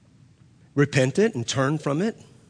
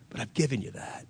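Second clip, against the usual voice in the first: soft, hushed reminder; gentle divine reply; 3 semitones lower, 8 dB quieter than usual. Retold or imitated. God reminding the pastor of the answered prayer, gentle reply. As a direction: say it much softer, a tender reminder.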